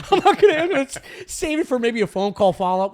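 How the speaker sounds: high, loud laugh-talk; amused self-mockery. Real, not acted. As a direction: giggle through the excuse. Laughing at himself.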